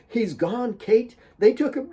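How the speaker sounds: high urgent insistence; firm insistence. A narrator's reading. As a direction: make her hear it. Jamina insisting to Kate.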